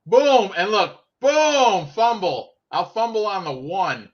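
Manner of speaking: high, loud booms, easing off; ridiculing replay call; mocking play-by-play. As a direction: gleefully re-enact the blunder, punching each boom.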